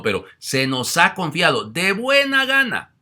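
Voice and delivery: loud, high, slow pastoral plea; slow exhortation. Acted. Priest urging pastors to tend the flock, loud and slow. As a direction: drag it out as a solemn charge. Quoting scripture forcefully.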